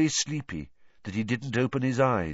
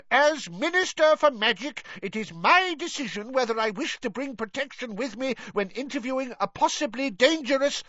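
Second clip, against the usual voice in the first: high, blustering official voice; pompous minister. A narrator's reading. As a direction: lecture her with wounded authority. Fudge keeps arguing.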